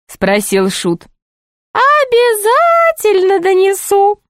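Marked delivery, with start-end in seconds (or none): 1.7-4.2 very high, slow jester voice; high, drawn-out jester taunt; tattling jester. Acted. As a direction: draw out the threat in a piping pitch.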